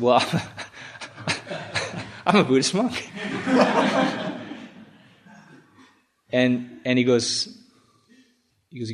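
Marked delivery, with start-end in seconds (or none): 0.0-4.3 high giggling delivery of the line; laughing through it. Retold or imitated. Monk laughing as he tells the policeman who he is. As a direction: laugh while admitting being a monk. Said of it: "again, it's kind of refrain".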